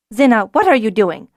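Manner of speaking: loud, sharp demand. Acted. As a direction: demand an answer from Zinna. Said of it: "Elor demanded".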